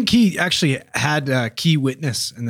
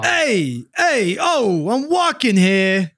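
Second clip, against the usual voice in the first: mock New York–Jersey tough guy accent; hammed-up Jersey wiseguy accent; 4 semitones higher, much slower than usual. S1 acts a New Jersey witness.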